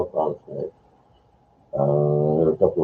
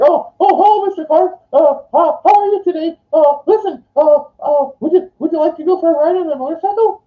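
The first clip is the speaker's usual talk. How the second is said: very high, loud, stammering falsetto; mock a flustered underling squeaking at the boss; hammed-up nervous squeak, halting then rushed; imitating intimidated coworker; 5 dB louder than usual. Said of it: "he was really intimidated by this guy".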